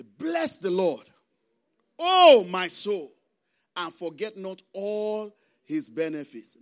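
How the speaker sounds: slow declamation with a booming loud Oh; psalm proclamation. Real, not acted. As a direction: proclaim it slowly, swelling then easing off. Proclaims the psalm to close point.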